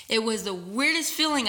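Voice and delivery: normal talking voice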